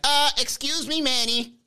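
high, nasal-free snooty pedant voice; nitpicking commenter. Retold or imitated. Commenter correcting Manny, high snooty voice. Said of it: "somebody in the comments would be like".

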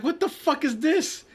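very high-pitched retold disbelief; incredulous. Telling friends about her math problem, incredulous.

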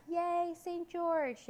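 high, loud, drawn-out cheer; children's yell. A narrator's reading. Children cheer on Saint George, high long yell. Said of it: "yelled the old woman's children".

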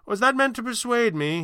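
a lightly voiced, amused stranger. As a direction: toss it back, amused. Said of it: "he asked, amused".

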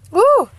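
loud, high "woo"; sharp rising-falling cry of release; high whoop. Real, not acted. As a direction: whoop to shake off the tears. Collecting herself after happy tears.

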